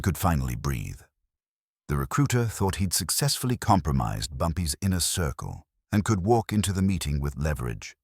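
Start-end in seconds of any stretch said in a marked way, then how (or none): none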